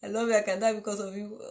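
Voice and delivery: fast singsong rattle of the suitor's line; recited like a memory verse. Retold or imitated. Mocking the boy who courted her mother, fast recitation. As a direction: rush it out like a memorized Bible verse. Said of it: "as if it is memory verse".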